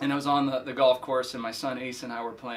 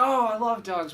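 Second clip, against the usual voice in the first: excited; 9 semitones higher, 6 dB louder than usual. Retold or imitated. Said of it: "I said".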